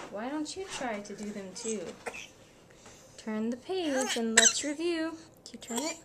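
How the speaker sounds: usual speaking voice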